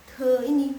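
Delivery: te with a puff of air; aspirated te. Shown as a demonstration. Teacher demonstrating the aspirated te, loud. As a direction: breathe out hard on the te in "te ini". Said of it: "pas pelafalannya dia ada H".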